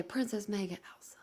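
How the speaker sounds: reading a name under the breath; whisper. Real, not acted.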